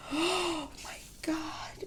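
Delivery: gasp, then a slow whispered oh my God; breathless, slow, under the breath; awed gasp. Real, not acted. Seeing her name on the custom dashboard, awed whisper.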